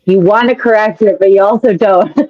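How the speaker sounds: normal talking voice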